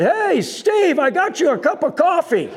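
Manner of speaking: very high, loud retold call. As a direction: imitate a heckler hailing the preacher. Heckler returns, calling to preacher.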